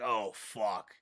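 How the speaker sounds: low, soft dismayed curse under the breath; muttered oh fuck. Retold or imitated. Seeing the Marshal, a quiet dismayed curse. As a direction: let it slip out quietly in dread. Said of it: "And I'm like".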